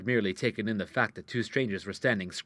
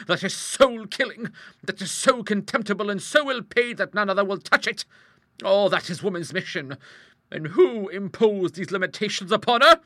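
loud, high oratory; impassioned rhetorical outburst; indignant tirade; 10 semitones higher, 9 dB louder than usual. Acted. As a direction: declaim like a fiery campaigner. Hostess's indignant speech after cry.

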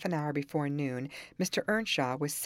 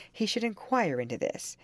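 plain reading voice

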